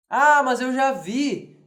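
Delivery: mock objecting student; lightly voiced skeptic; imagined objection. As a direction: voice a doubtful student. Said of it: "Alguém pode dizer assim".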